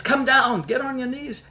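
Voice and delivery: high, lightly imitated broken English order. Kneeling soldier calls passenger down, in broken English. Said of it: "he talks in broken English".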